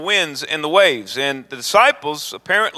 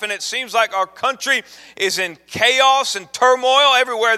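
loud, high, driving sermon build; preaching at full force; 7 semitones higher, 3 dB louder than usual. Acted. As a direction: preach it out to the congregation. Preaching climax, rising intensity.

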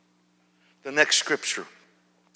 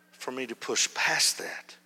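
ordinary delivery

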